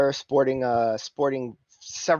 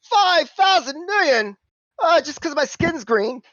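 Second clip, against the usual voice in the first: mock outrage; 6 dB louder than usual. [name] balking at the crew's fee, mock outrage. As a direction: balk at the price, scandalised.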